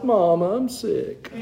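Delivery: mock sick kid, rising high and soft; sick little child. Sick child calling for his mother, mock pitiful. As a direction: whine softly to Mama, imitating a child. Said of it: "As a little child, when I would be sick".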